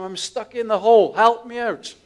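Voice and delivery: high, loud call up out of a pit; shouting for help. Retold or imitated. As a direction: yell up for help from the hole. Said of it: "he shouts up to the priest".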